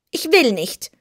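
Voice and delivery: a stubborn little cat refusing; sulky kitten. A narrator's reading. Kitten under the bed sulkily refuses Dorothy. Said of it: "erwiderte das Kätzchen mürrisch".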